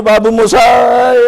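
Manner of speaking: slow drawn-out mock filmy Rajesh Khanna; a light touch of Rajesh Khanna drawling; Rajesh Khanna line. Trainer mimics Rajesh Khanna for students, slow filmy drawl. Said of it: "Rajesh Khanna के साथ होता".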